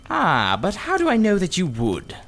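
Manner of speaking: put-on sly old uncle, smiling; a hint of cunning in an old man's voice; cunning smile. A narrator's reading. Uncle doubting the children will return, cunning and smiling. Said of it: "with a cunning smile".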